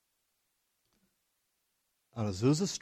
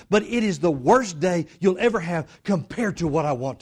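full-force preaching; 9 semitones higher, 9 dB louder than usual. Real, not acted. Preacher urging believers that better days are coming, full-force preaching. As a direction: preach it out to the whole room.

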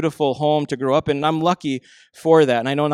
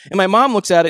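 ordinary talk, nothing marked

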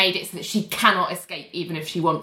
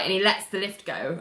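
ordinary delivery

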